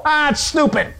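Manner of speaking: high, dismissive scoff. Acted. Bitten man refusing the cure, scoffing. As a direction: wave the idea away with contempt.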